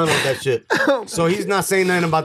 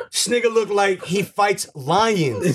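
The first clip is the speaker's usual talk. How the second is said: normal talking voice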